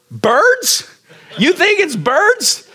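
high, slow questioning lady voice; lightly voiced neighbor lady. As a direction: play the puzzled neighbor asking sweetly.

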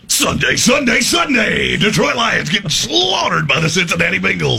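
high, shouted event-announcer hype; over-the-top tractor pull promo shout. Acted. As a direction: holler it like a monster-truck rally ad. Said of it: "tractor pull voice".

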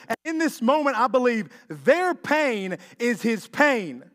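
loud, high preaching cry; full-force preaching. Acted. Preacher urging the congregation on the delay, loud preaching. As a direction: preach it out to the whole room.

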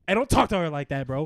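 loud, quick warning; mock protective anger. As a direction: jump in fast and loud to defend a date. Boyfriend defends date in skit.

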